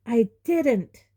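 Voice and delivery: put-on boy denying accusation sharply. A narrator's reading. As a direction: snap it back. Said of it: "Harry said sharply".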